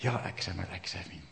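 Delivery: soft, low, heartfelt plea to God; a believer calling on God from his bed; heartfelt prayer. Retold or imitated. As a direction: pray it quietly from the soul. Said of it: "با تمام جانش میگه".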